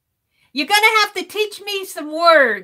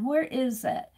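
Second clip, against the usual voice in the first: usual speaking voice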